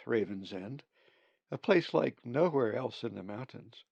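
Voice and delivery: plain reading voice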